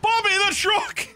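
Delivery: high, loud cry of alarm; alarmed shout. Real, not acted. Mate yelling as Bobby drives off the pier, alarmed shout. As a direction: yell as the truck goes over.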